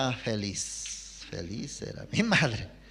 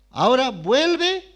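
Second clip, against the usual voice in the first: put-on higher, louder command to the boy; grandmother's order; 10 semitones higher, 7 dB louder than usual. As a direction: send him off home. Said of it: "Y luego me dijo".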